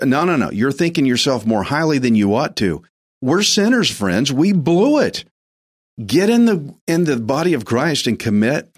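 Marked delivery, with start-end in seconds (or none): 3.2-8.7 fervent preaching. Real, not acted. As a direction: raise it up like a revival call. Preaching climax.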